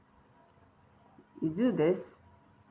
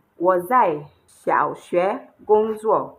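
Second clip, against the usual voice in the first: slow and louder, clear Chinese; slow model sentence; 6 dB louder, slower than usual. Shown as a demonstration. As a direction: read it out for pupils to copy. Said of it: "I'll say that one with my mouth".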